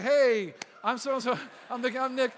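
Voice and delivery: loud mock announcement to the school; calling out. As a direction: shout hello, then introduce yourselves loudly. Acted pair announcing themselves at school.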